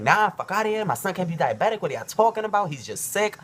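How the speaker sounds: high, loud disbelieving outburst; mom spazzing out. Retold or imitated. Son retelling his mom refusing the diagnosis, loud and high. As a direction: blurt it like a mother refusing bad news. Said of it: "She started spazzing out".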